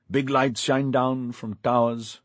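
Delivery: plain reading voice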